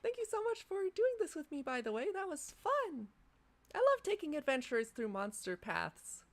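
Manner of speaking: high, bright-toned friendly guide voice; high NPC guide voice. Acted.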